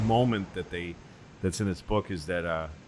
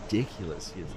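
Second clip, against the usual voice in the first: mocking FDR aside; 3 semitones higher than usual. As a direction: sneer about him behind his back. FDR mocking Churchill to Stalin.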